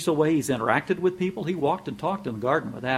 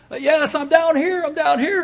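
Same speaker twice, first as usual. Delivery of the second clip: frantic reply; 7 dB louder than usual. Retold or imitated. Jack answers from cliff.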